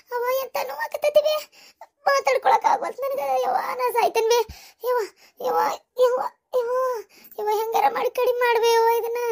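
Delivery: high, tearful moaning; anguished labour wail; moaning in pain.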